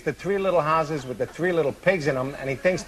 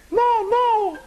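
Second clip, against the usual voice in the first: a high little pig squeal of no; high-pitched pig refusal; squeaky pig no; 3 dB louder than usual. Acted. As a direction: refuse in a tiny high piggy voice. Pig refuses third wolf.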